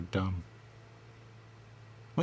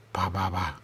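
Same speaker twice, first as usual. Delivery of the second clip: usual speaking voice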